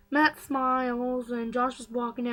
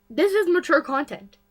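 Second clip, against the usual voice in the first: louder, higher reminder; loud warning; 5 semitones higher, 10 dB louder than usual. Real, not acted. Vlogger warning viewers about mature content, loud. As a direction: call it out as a warning.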